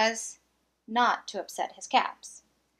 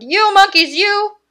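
furious shout; 7 semitones higher, 13 dB louder than usual. Acted. Peddler at the end of his tether, bellowing at the monkeys. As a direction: bellow every word at them. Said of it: "he shouted".

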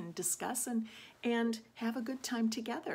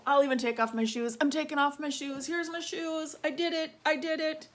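higher, quick playful play-along; giocosa messinscena; 7 semitones higher, 5 dB louder than usual. Pretend. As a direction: chirp it brightly while acting along. Acting out removing her shoes.

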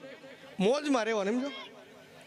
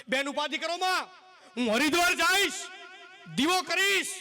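grand saint promise; 6 semitones higher than usual. Acted.

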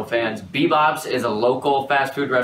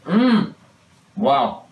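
chewing while talking; muffled by food; mouth full of burger; 4 semitones higher, much slower than usual. Real, not acted. Seen 0: Both blindfolded people hold burgers at their mouths; unclear who speaks.

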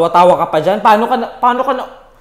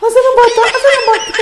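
panicked; 5 dB louder, faster than usual. Real, not acted. Player panicking to viewers about the missing baby, frantic and high. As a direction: call out high and worried, voice climbing.

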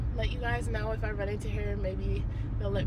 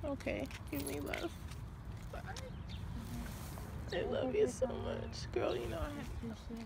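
much softer, murmured goodbye; hushed; 5 semitones higher, 14 dB quieter than usual. Real, not acted. Saying goodbye to girlfriend at dawn, hushed.

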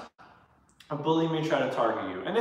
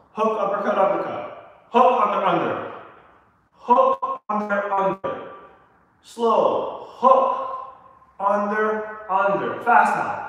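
calling out combos; 4 semitones higher, 4 dB louder, slower than usual. Acted. Coach calling out punch combos.

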